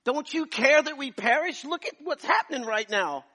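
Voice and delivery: high, loud, desperate cry to God; a husband screaming for help as his wife stops breathing; screamed prayer. Retold or imitated. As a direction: scream the plea like a drowning man. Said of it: "I was screaming like".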